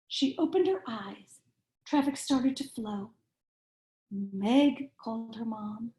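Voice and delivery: lively picture-book read-aloud, rhyming bounce; reading to children. A narrator's reading. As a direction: read it like bedtime story time for little kids.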